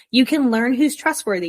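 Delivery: usual speaking voice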